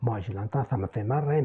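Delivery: ordinary talk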